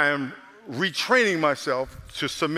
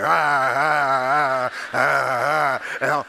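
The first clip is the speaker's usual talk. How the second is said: mock crying; 2 semitones lower, 4 dB louder, much slower than usual. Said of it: "I'm like".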